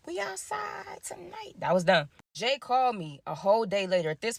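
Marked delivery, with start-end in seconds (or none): 0.1-1.5 high, slow and much softer, mock breezy; carefree partygoer quote. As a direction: toss it off like a party girl. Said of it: "they like".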